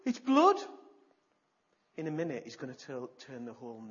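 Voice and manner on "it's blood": high, loud astonished cry; shocked exclamation. Acted. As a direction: cry out seeing the blood. Water becomes blood.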